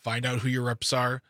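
normal talking voice

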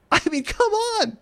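high exasperated plea. Real, not acted. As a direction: come on, in disbelief. Exasperated, pitch jumps high.

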